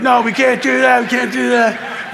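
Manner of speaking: loud, high mock refusal, slowing at the end; panicked protest. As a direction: protest in a hammed-up high shout. Apostles protesting to Judas.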